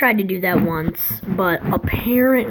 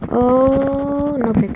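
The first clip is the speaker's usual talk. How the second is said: sudden high Oh, louder; surprised; 5 semitones higher, 4 dB louder, much slower than usual. Real, not acted. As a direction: react out loud to the play. Reacting to game play.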